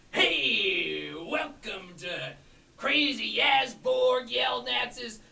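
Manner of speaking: over-the-top fish-man merchant hawking potions; wacky potion seller. Acted. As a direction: sell it like a carnival huckster. Player voices potion seller figure.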